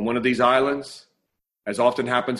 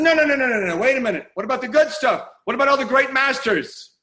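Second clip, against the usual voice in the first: protesting collector; 10 semitones higher, 4 dB louder than usual. Acted. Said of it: "He says".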